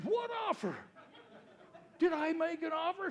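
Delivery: high, incredulous with a laugh; can't believe his own offer; astonished. Retold or imitated. Surprised by accepted offer.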